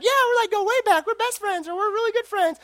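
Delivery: put-on high, quick girlish chatter; lightly mocking girly voice; bubbly wife. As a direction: voice the wife gushing about her best friend. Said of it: "she's just like".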